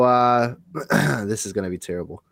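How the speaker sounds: usual speaking voice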